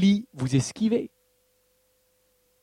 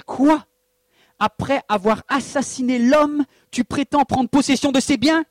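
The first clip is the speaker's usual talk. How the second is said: higher and louder, outraged Quoi; indignant rebuke; 7 semitones higher, 4 dB louder than usual. Acted. God confronting Akab over Naboth's vineyard, outraged and loud. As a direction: thunder at him for the murder.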